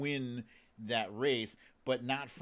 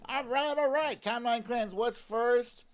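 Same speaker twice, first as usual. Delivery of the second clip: much higher, bright hype-up; pumped host kicking off the segment; excited hype; 10 semitones higher, 5 dB louder than usual. Real, not acted. Hyped segment opener.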